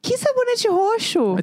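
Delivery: high, slow baffled question; puzzled question. Real, not acted. Wife puzzled by the purple soap remark, high and slow. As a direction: ask it high and drawn out, genuinely lost.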